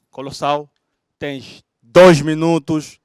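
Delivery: loud, slow drawn-out call; announcer shout. Real, not acted. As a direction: call it out to the whole crowd. Host calling out the rules.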